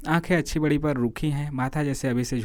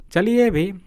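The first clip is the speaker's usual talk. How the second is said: impatient, louder and higher push to leave; irritated urging; 8 semitones higher, 7 dB louder, slower than usual. Acted. Narrator, bored on the cold bench, urges his friend: irritated. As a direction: snap at the friend to get going, fed up. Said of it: "मैंने खिसिया कर कहा".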